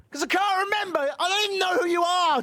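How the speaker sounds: high-pitched, exasperated outburst; hammed-up high, strained protest; frantic excuse. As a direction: blurt it out in rising mock panic. Acted excuse to imagined Julie.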